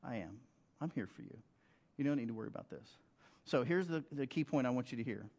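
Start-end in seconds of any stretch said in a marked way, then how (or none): 0.0-3.0 much softer, reassuring and steady; God reassuring a worried believer, softly; gentle voice of God. Retold or imitated. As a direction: speak as a calm, loving God. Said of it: "God's voice is solid, consistent, loving, and faithful".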